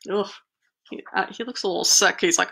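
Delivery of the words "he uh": ordinary delivery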